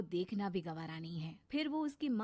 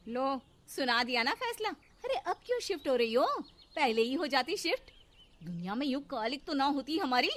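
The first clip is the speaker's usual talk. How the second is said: loud, biting taunts; a resentful stepmother sniping at the girl; sarcastic scolding; 3 semitones higher, 5 dB louder than usual. Acted. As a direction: scold with bitter sarcasm, voice pushed up.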